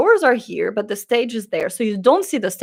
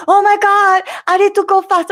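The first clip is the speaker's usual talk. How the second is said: high, loud comic fluster; mock panic; 10 semitones higher, 4 dB louder than usual. Friend running to the toilet, comically panicked. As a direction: imitate a frantic runner.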